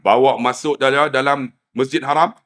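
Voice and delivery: ordinary talk, nothing marked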